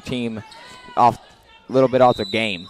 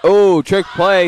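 higher, louder, drawn-out exclamation; excited reaction; 7 semitones higher, 7 dB louder, slower than usual. Real, not acted. Commentator spotting a trick play, excited. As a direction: react live to a sneaky play, loud and slow.

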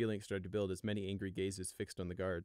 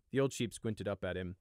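plain reading voice

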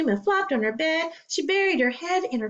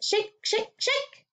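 rising higher with each shake; rattly shake; 9 semitones higher, slower than usual. Acted. Child describing rattling seed pods, rising playful.

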